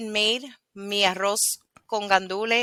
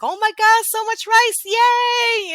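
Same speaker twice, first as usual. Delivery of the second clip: high, loud delighted cheer; imitating overjoyed coworkers, drawn-out yay; happy coworkers; 13 semitones higher, 4 dB louder, slower than usual. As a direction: squeal it like a thrilled crowd. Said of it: "And they were happy".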